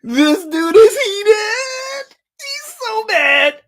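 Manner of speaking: high, very slow words stretched out by laughter; dragging each word out while laughing; laughing slowly. Real, not acted. Streamer laughing at how mad Kirk Cousins is.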